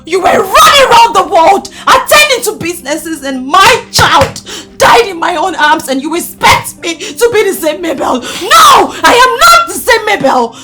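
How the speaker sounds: loud, anguished accusation; loud, tearful outburst; grief-stricken fury. Acted. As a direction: shout it through grief, rising on NO. Grieving mother accuses absent husband.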